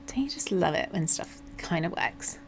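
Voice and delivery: soft unvoiced whisper. Real, not acted. Streamer whispers a pleased aside to viewers. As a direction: breathe it to the chat as a secret.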